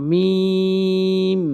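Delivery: মিম with the m held long; মিম pronounced slowly with long nasal hum; drawn-out meem. Shown as a demonstration. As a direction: stretch মিম slowly, lips closed. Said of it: "টেনে পড়ব".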